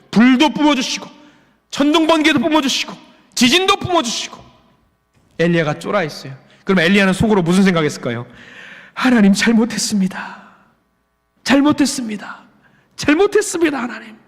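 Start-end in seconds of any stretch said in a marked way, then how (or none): 0.1-4.4 building drama. Acted. Dramatizes God's wrath, climbing. 8.9-14.2 terrified pleading, pitch climbing each time; frightened begging. Acted. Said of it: "아마 속으로 얼어가지고 그렇게 이야기했을 거예요".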